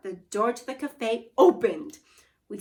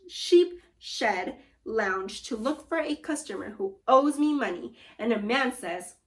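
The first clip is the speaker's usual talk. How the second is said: plain reading voice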